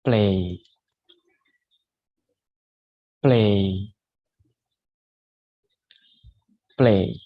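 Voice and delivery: a clear "Play" for students to copy; teacher modelling "Play"; model the word play. Shown as a demonstration. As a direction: say "Play" with a full ei glide. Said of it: "Please repeat after me".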